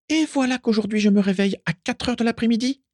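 very high-pitched, indignant voice; shrill. A narrator's reading. Carla protesting she woke at four, shrill. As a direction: let the pitch soar in outrage. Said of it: "d'une voix suraiguë".

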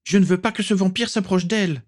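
high-pitched, protesting yell. A narrator's reading. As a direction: yell in protest, pitch held high, without much volume. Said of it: "hurla John".

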